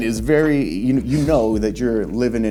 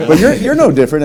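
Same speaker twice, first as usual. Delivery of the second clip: laughing; 4 semitones higher, 9 dB louder, faster than usual. Real, not acted. Host laughs at cohost joke.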